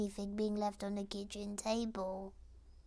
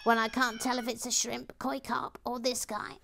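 loud character voice; 8 dB louder than usual. Acted. Character reads chat message aloud.

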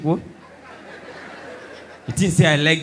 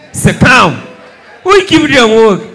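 loud, slow indignant yell, higher; laughing shout; 3 semitones higher, 8 dB louder, slower than usual. Acted. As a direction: bark it out in mock outrage. Comic outburst at crowd.

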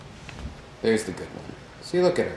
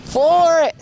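shout; 6 dB louder than usual. Real, not acted. Urging friend's car to slide.

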